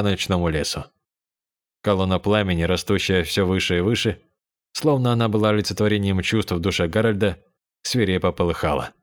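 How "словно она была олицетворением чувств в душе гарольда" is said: ordinary delivery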